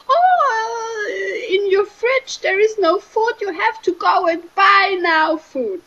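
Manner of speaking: high, nagging fuss about groceries; fussing brain voice. Retold or imitated. The brain reminding about the empty fridge, high fussy nagging. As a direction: play the brain as a fretful worrier. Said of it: "maybe the brain is saying".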